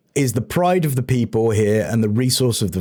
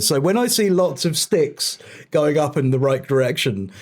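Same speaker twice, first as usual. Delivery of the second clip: ordinary talk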